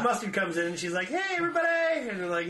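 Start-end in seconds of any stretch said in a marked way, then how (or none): 1.1-2.1 high and loud greeting; mock cheery entrance. Pregnant friend entering, high cheery mock. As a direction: burst in with a cheer.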